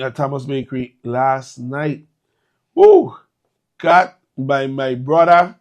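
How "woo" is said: a loud, high woo of delight; excited woo. Real, not acted. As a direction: let out a happy whoop. Host recalls party bingo night.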